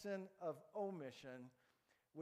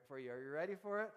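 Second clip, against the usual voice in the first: usual speaking voice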